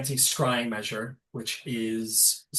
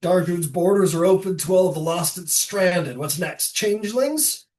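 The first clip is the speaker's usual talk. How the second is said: scoffing ruler; 5 semitones higher, 6 dB louder than usual. Acted. Lesh mocks open borders.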